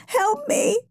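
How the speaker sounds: small, high, desperate voice; sobbed plea. A narrator's reading.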